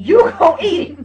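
loud, high mock scolding; stern parental order. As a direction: hammer the order home, half laughing. Retold order to son.

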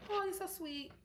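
high, sugary mock-sweet voice; gushing high thank-you; sweet reply. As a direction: coo it back playfully. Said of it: "I'm like".

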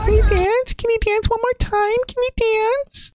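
very high, mocking imitation of the annoying lady. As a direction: parody her shrill robot-test question. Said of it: "she's annoying to me".

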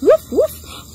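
two high-pitched playful barks; little dog bark. Shown as a demonstration. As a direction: yap like a small dog. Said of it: "This is a little dog".

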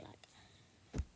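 ordinary delivery

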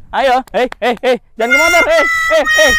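high, loud warning calls; urgent calls. Real, not acted. Owner warning his bird off the motorbike, high and urgent. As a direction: shoo him away from the motorbike.

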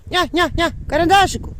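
high, loud call across the yard; calling the dog. Real, not acted. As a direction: call your dog from far away. (голосно кличе собаку)

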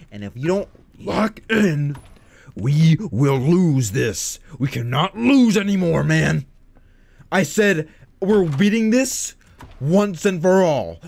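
loud, emphatic pep talk; stern rallying speech. Acted. Streamer ordering viewers to lock in, loud and emphatic. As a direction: lay down the law, loudly.

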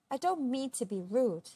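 mock-polite with a light smile; gentle storybook needling; smiling politeness. A narrator's reading. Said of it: "Una smiled".

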